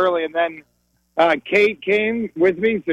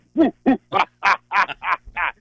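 demonstrated mock-evil cackle; playful Halloween villain laugh; spooky laugh. Shown as a demonstration. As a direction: toss in a quick campy ooh-ha-ha. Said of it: "I throw in a lot of".

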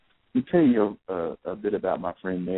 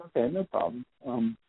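higher, eager voice for the man; lightly voiced man, pitched up and hopeful; hopeful man asking; 8 semitones higher than usual. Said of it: "The guy goes".

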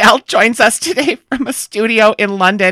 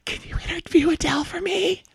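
high, quiet tearful squeak; hammed-up sobbing plea; crying; 9 semitones higher, 10 dB quieter than usual. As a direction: squeak out the request through tears. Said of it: "ran into the bathroom crying".

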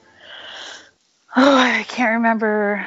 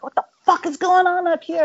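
higher, fast furious shout; furious yell; 10 semitones higher, faster than usual. Retold or imitated. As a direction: storm in yelling at him. Bandmate bursts in on attacker.

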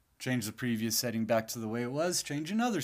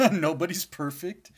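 louder, laughing as she speaks; 5 semitones higher, 5 dB louder than usual. Real, not acted. Laughing at own frustration.

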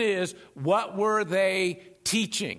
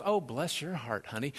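Southern lady; 6 semitones lower, 6 dB quieter than usual. Acted. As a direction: coo it low like a Southern lady. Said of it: "some Southern lady goes".

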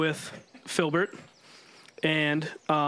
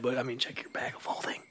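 whisper; 3 semitones lower, 7 dB quieter than usual. Real, not acted. Hushed worry about Filbert.

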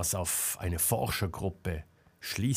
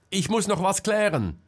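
high, loud call over distance; calling back; 10 semitones higher, 5 dB louder than usual. A narrator's reading. Selma calling back to Marcel, raised voice. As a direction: call back while hurrying away. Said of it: "rief Selma".

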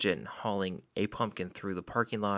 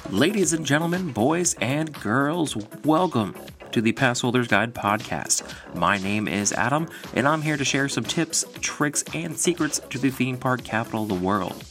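ringmaster; 6 dB louder than usual. Acted. Podcast intro, showman welcome.

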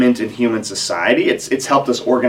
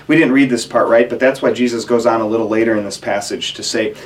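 ordinary talk, nothing marked

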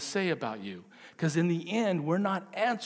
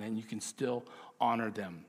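normal talking voice

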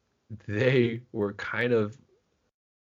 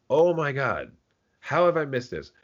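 higher and louder, replaying the thrill; retold excited gasp; 8 semitones higher, 5 dB louder than usual. Host recalling seeing Winona Ryder, excited higher louder. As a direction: gush like a fan who just spotted a favorite. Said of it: "I was like".

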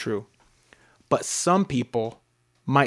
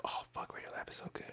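whispering; 22 dB quieter, faster than usual. Real, not acted. Whispered awe about Radio Lab.

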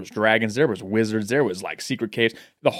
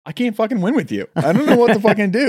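exasperated; 11 semitones higher, 6 dB louder than usual. Pretend. Mock exasperation at friend.

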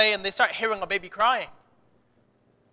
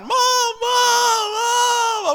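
put-on high, crying child calling out, louder; crying for mom; 6 dB louder, much slower than usual. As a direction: wail for mom like a lost little boy. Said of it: "I start crying".

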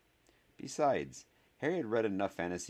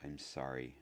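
low-key hoarse apology, softer; 3 semitones lower, 7 dB quieter than usual. A narrator's reading. Harry apologizing once more to hurt Neville, hoarse. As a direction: say it softly, voice gone hoarse. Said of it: "his voice now hoarse".

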